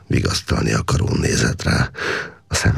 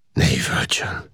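hushed whisper. A narrator's reading. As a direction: breathe it without voice to calm him. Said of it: "suttogta Káptalan".

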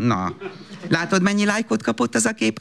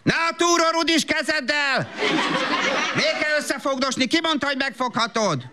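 annoyed scolding; 9 semitones higher than usual. Acted. Mother scolds child in shop.

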